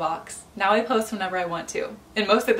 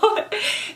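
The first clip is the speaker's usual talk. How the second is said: tearful laugh; 13 semitones higher than usual. Real, not acted. Tearful laugh before crying.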